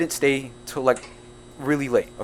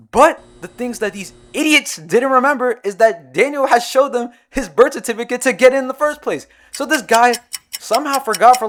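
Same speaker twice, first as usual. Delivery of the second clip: higher and louder, heated retelling; worked-up complaint; exasperated rant; 8 semitones higher, 8 dB louder than usual. Real, not acted. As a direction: vent about the idiots. Ranting about friends' blunder.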